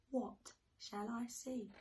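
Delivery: ordinary delivery, nothing marked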